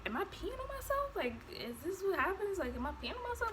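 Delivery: high, soft confused voice, retold; bewildered question. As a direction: ask your partner in small-voiced alarm. Asking partner as waters break, acted.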